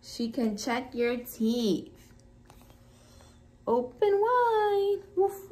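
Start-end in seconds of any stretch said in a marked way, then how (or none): 3.7-5.0 vet asking to open. Acted. Vet checks the puppy's teeth. 5.2-5.5 high, yappy woof woof; puppy barking. Acted. Biscuit the puppy barks at the vet, high and yappy.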